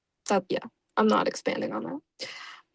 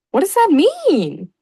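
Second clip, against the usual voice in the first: slow, higher, louder baffled question; 7 semitones higher, 6 dB louder, slower than usual. Real, not acted. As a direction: ask it in disbelief.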